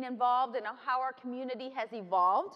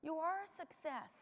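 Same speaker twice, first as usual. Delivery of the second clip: soft, raised, gentle voice; tender reassurance; 5 semitones higher, 8 dB quieter than usual. Retold or imitated. Granddaughter comforts her crying grandma, softly and tenderly. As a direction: reassure softly, in a retold moment.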